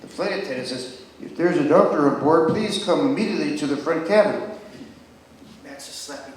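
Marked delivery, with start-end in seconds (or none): 1.2-4.4 louder airline PA announcement; loudspeaker call. Retold or imitated. Flight attendant asking for a doctor over the PA, louder announcement. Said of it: "over the loudspeaker".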